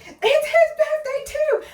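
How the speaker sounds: very high, loud, drawn-out yell; re-enacted shout. Birthday girl telling passers-by of his birthday, high loud shout. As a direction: yell out the extra news. Calling out about the other birthday.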